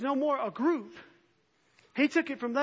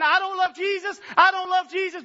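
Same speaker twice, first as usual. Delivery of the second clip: mock scream, higher and louder; 9 semitones higher, 11 dB louder than usual. Preacher voicing what lives scream, loud shout. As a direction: shout it out as the hidden truth. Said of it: "you are screaming".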